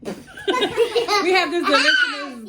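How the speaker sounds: giggling through the words; laughing. Real, not acted. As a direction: laugh while you say it.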